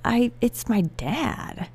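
touched and high, drawing the words out; emotional recollection. Real, not acted. Coaching client naming the voice as dad, emotional. Recalls tearful answer about dad.

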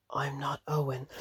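soft, eerie imitation of a demon; supernatural voice. Demon answering the wife's "I love you", hushed supernatural voice. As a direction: quietly imitate an otherworldly voice. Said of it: "this really deep supernatural voice".

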